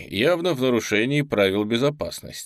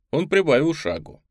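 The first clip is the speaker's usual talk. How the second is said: ordinary delivery, nothing marked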